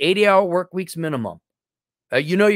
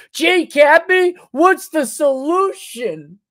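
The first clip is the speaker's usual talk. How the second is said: much higher, slow pleading voice; mock whiny kid; 11 semitones higher, 4 dB louder, slower than usual. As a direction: beg like a clueless kid. Mock helpless student asking.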